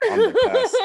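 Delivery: cocky brag that breaks into a high laugh; laughing boast. Retold or imitated. Boasting to friends after dodging the cop, laughing. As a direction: crow about it, cracking up.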